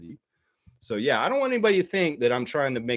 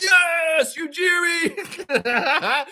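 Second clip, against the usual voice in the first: high, drawn-out laughing shout; mock fan shouting his name; fan cheer; much slower than usual. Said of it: "They'd be like".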